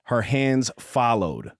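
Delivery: slow, low romance narration; audiobook narration. Acted. Host reading a spicy romance passage aloud, measured narration. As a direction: read it like a slow, intimate audiobook.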